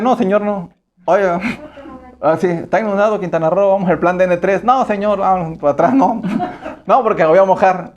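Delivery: a soldier whining out of duty; lightly hammed-up reluctant soldier; mock soldier refusing. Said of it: "él dice".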